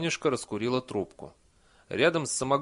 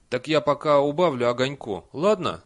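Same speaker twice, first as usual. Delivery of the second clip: loud, slightly raised call; calling after; 4 semitones higher, 7 dB louder than usual. A narrator's reading. Villager calls after departing Nanny, loud. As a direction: call it out to someone walking off. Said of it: "крикнул ей вслед беднокур".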